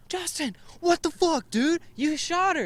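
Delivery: high, slow, shocked yelling at a friend; yelling at the friend who fired the shot; panicked yell. Retold or imitated. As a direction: scream it at him in disbelief. Panicking after friend was shot.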